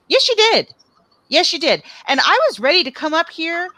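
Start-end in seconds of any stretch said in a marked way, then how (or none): 0.1-1.8 sharp, much higher "yes, you did"; indignant insistence. Real, not acted. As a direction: shoot the denial down hard. Insisting against Nikki's denial.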